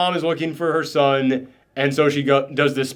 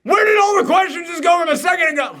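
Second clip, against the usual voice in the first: much higher, disbelieving outburst; incredulous shout; 11 semitones higher than usual. Real, not acted. Reviewer mocking the film's logic, incredulous high shout. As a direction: demand an answer from the screen. Heated rant about the movie.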